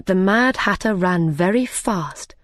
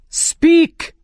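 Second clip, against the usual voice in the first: commanding; 10 semitones higher, 5 dB louder than usual. Acted. King commands the silent cook.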